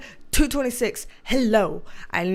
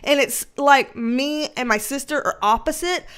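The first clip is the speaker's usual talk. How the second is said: ordinary delivery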